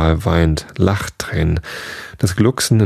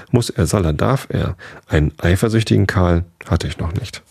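ordinary delivery

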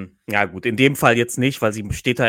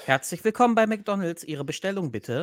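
drive thru speaker; 7 semitones higher than usual. Retold or imitated. Parodiert McDonald's-Bestellansage.

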